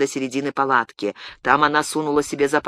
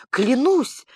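high eager cry; exclaiming; 8 semitones higher than usual. A narrator's reading. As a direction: swear it with a burst. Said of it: "воскликнула Уарда".

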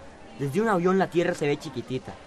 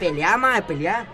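cheering on; 4 semitones higher, 5 dB louder, much slower than usual. Acted. Egging on a play fight.

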